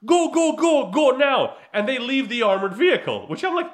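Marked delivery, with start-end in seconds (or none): 0.0-1.6 mock officer yell. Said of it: "So the guy says".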